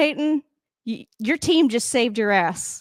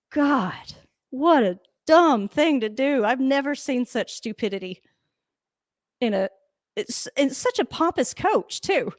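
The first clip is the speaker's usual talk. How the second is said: worked-up rant; 3 semitones higher than usual. Real, not acted. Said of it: "I'm getting worked up on this one".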